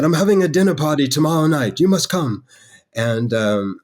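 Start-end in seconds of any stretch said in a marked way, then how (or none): 0.0-2.4 much higher, louder self-mocking British accent; bad British accent. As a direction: ham up a British accent, pitched high. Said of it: "pardon my horrible British accent".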